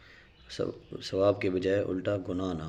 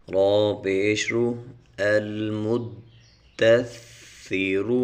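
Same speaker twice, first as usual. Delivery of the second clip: slow, measured tajweed recitation; Quran recitation; 6 dB louder, much slower than usual. Shown as a demonstration. Teacher spelling Quran syllables for students, slow chant. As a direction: chant each syllable slowly and clearly.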